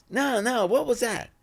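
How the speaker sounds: high-pitched baffled imitation; puzzled audience member. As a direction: mimic someone insisting they missed it.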